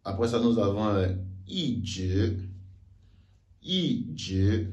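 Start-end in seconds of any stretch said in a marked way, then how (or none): 1.5-2.3 "i je": falling "i", rising "je"; fourth-tone yi. Shown as a demonstration. Teacher shows second-tone case, falling "i" before "je". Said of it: "accompagné du deuxième ton". 3.6-4.7 "i je": falling "i", rising "je"; fourth-tone yi. Shown as a demonstration. Teacher shows second-tone case, falling "i" before "je". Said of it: "accompagné du deuxième ton".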